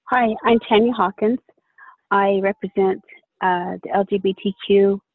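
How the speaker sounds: ordinary talk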